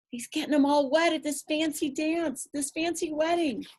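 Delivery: ordinary delivery, nothing marked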